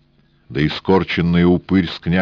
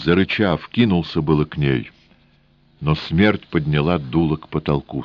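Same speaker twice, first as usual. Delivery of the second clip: usual speaking voice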